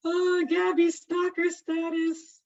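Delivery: high, loud, slow mock-accusing tease; stretched, sing-song stalker jibe; playful teasing. Real, not acted. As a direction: drag out the accusation with a grin. Teases student about finding her house.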